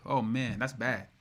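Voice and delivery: ordinary talk, nothing marked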